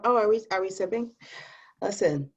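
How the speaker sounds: ordinary talk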